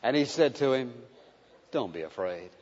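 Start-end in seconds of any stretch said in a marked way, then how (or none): 1.7-2.5 tender reassurance. Acted. Jesus touching fearful John.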